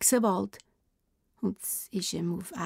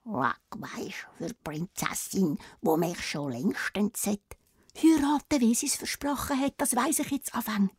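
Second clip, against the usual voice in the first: whispering frog; 5 dB quieter than usual. Acted. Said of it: "flüstert der Anna zu".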